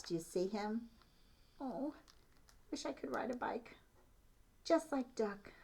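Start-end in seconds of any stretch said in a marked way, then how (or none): 1.6-5.5 higher, soft breathy wish; wistful little mouse. Shown as a demonstration. Little mouse dreams of riding, wistful and soft. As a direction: sigh it like a tiny longing mouse. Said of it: "So look at the little mouse".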